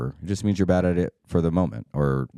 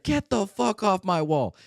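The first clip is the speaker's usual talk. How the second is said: much higher, slow, drawn-out drill sergeant bark; drill instructor yelling; slower than usual. Retold or imitated. Drill instructor ordering Pyle off the wall, slow high mock bark. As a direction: drag each word out like an angry sergeant. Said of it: "he was yelling at him".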